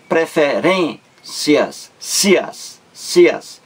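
"preferências" slow, then "cias" repeated on its own; isolating last syllable. Shown as a demonstration. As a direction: detach and echo the "cias" of "preferências". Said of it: "Cias é a última sílaba". ("preferências" devagar, repetindo "cias" separado)

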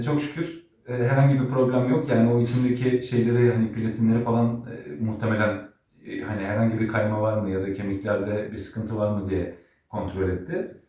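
ordinary talk

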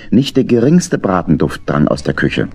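ordinary delivery

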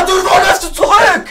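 high, wailing complaint; frustrated wail. Real, not acted. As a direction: cry out in exasperation at the player. Frustrated at player running back.

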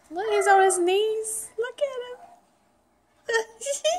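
rising delighted squeal; thrilled, voice climbing high; excited. Real, not acted. Mom excited at baby crawling.

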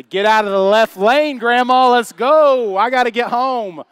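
loud, high road-rage holler; impatient yelling. Retold or imitated. Driver yelling at Grandma in left lane, impatient shout. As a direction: holler at the car ahead.

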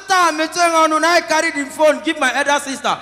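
high, loud call to mom; shouting into phone. Retold or imitated. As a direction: yell it down a bad line.

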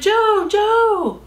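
high, loud calling of a name; shouted call. Acted. Tekla shouting to Jo from far away, high and loud. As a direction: call out across the distance. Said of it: "she shouted".

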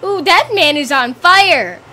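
ordinary talk, nothing marked

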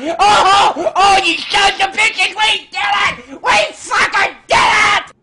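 shrieking, laughing celebration; shrieking jackpot winner. Real, not acted. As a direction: scream with joy like you won. Celebrating victory, shrieking with joy.

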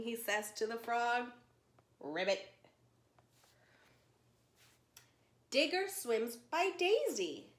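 lively storybook read-aloud for little ones; reading to children. Acted. Teacher reading a zoo story to children, lively storytime voice.

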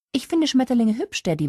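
put-on very high, innocent child voice; innocent little girl. A narrator's reading. Small daughter to Daddy about butterflies, innocent. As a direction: pipe up like a guileless five-year-old. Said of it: "meinte Emily mit großen, unschuldigen Augen".

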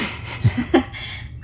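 airy laugh, almost silent; breathy laugh. Real, not acted. [name] laughs softly back at the teasing Rick. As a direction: laugh lightly through the breath. Friends laughing together after reunion.

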